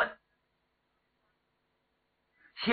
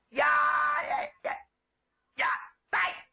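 warrior's shout; 9 semitones higher than usual. Acted. Warrior hails the whole training ground, shouting high. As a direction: yell out in broken, stretched bursts. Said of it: "冲着教场的周围就喊开了".

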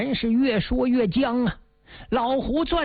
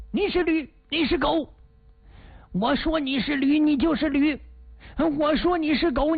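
higher-pitched taunting voice of the red-faced man; a touch higher, mocking and stubborn; 6 semitones higher than usual. A narrator's reading. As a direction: needle him, voice pitched up.

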